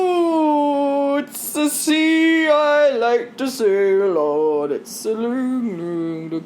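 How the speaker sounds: sway through it like a tipsy panda; drunk panda. Acted. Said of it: "I've got a passed out panda".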